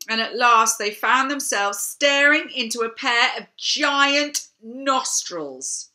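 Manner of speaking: usual speaking voice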